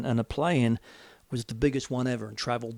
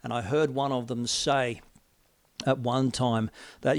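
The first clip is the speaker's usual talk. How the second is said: ordinary talk, nothing marked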